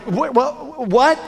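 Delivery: incredulous, stumbling double take; retold disbelief. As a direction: act out being stunned by good news.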